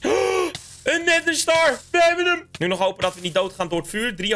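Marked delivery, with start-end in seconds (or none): 0.0-2.5 high, slow, drawn-out exclamation of triumph; triumphant. Real, not acted. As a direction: exult over a long-sought find. Finds the star in the chest. (triomfantelijk, hoog en langgerekt)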